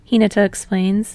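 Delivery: usual speaking voice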